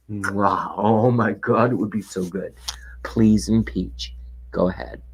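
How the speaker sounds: slow breathy whisper of mock longing; taunting whisper. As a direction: hush it out like a teasing dare.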